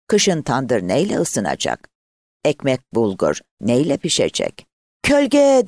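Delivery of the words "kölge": high, loud hawker's call; one long, ringing peddler's shout; street seller's cry. A narrator's reading. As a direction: call out your wares to the whole village. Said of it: "O satıcı ağzını bırakmadan".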